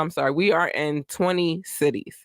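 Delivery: usual speaking voice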